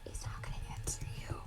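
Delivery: fast, barely audible whisper; real hushed whisper to a player; whispered aside. Real, not acted. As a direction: slip it in under your breath, whispering. Game master whispers an aside.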